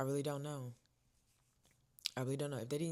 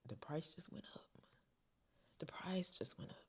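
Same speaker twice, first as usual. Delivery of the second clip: whisper; 3 semitones higher, 7 dB quieter than usual. Retold or imitated. Leaving the test centre.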